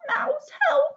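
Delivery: very high-pitched little plea; calling for help. Acted. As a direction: squeak out a call for help. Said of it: "So they called".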